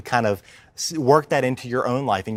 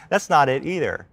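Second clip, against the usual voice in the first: ordinary delivery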